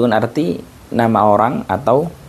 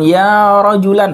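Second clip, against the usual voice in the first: blind man calling for help; 6 semitones higher, 3 dB louder, slower than usual. Shown as a demonstration. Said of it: "Dia mengatakan".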